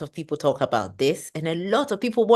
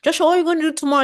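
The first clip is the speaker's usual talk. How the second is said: mock nagging self-question; 13 semitones higher, 5 dB louder, much faster than usual. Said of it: "a friend who goes like".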